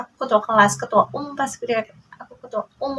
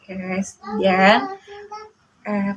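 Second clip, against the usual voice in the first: ordinary talk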